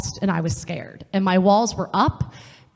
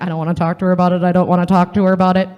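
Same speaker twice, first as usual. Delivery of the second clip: fast, louder, protesting gabble; reluctant whine; 2 semitones lower, 5 dB louder, much faster than usual. Retold or imitated. To the women, voicing the reluctant heart: fast, louder protest. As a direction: rattle it off like a reluctant kid.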